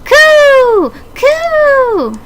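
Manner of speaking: high shrill bird coo cooed loud; pigeon coo. Acted. Pigeon calling out to friends with loud coos.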